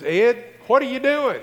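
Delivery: high, loud wife's demand; wife calling out. Retold or imitated. Wife comes out to husband mowing, calling out high and loud.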